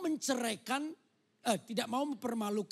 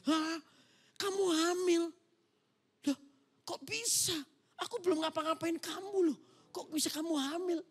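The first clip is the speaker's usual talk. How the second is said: much higher, stunned fiancé; shocked disbelief; 9 semitones higher than usual. Acted. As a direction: gasp out the disbelief. Joseph shocked by pregnancy news.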